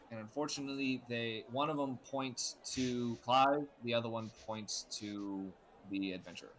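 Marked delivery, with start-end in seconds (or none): none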